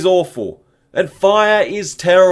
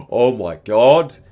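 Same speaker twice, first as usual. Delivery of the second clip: louder, slow, stunned "oh my god"; mock horror; 4 semitones lower, 5 dB louder, slower than usual. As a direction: draw it out in stunned dismay. Reacting to the aftertaste.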